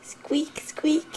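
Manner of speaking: high little mouse squeaks; playful mouse squeak. Pretend. Mother echoes the toy's mouse for her baby, high playful squeak.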